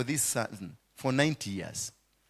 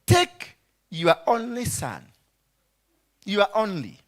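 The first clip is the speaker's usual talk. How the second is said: sarcastic; 5 semitones higher than usual. Acted. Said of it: "this God was very sarcastic".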